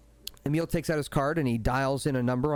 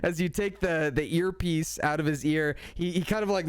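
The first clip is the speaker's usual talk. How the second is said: usual speaking voice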